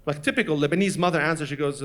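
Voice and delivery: normal talking voice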